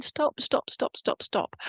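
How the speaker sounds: a breathy whispered plea, voice dropped away; whispered stop. Retold or imitated. Medium passing on a mum's plea, whispered. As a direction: medium relaying a spirit's urgent whisper to stop. Spirit urging stop, relayed.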